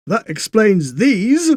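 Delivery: put-on a wry old gent drawing a conclusion; dry old railwayman. Railway man speaks.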